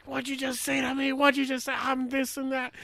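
high, indignant mock tirade; steaming-mad sergeant. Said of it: "literally see steam blowing out his ears".

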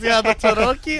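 put-on "Cherokee" with Mexican accent, hard first sound; mock Mexican accent. Salesman imitating a customer asking for a car, Mexican accent. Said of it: "Even, even our own people doing this".

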